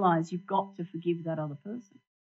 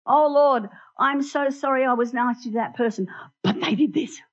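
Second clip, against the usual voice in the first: high, loud pleading Oh, Lord with a whiny but; a parishioner caricatured praying with excuses; mock self-justifying prayer; 6 semitones higher, 7 dB louder than usual. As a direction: overdo the sorry, then blame them. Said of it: "We go to Jesus and we say".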